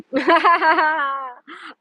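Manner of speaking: loud high burst of real laughter; big laugh. Real, not acted. Laughing out loud when a viewer calls her shady. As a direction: crack up at the teasing.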